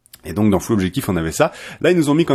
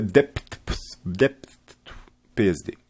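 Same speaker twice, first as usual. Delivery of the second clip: slow, laboured English word in a French accent; 5 dB quieter, slower than usual. Real, not acted. Tutorial host retrying an English word, French-accented English. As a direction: overdo the English ending through a French accent. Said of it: "J'ai vraiment un accent anglais".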